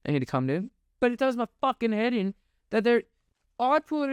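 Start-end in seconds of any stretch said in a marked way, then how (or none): 0.1-0.7 calming himself. Real, not acted. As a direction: lower self-soothing repeat.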